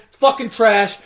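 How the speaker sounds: ordinary talk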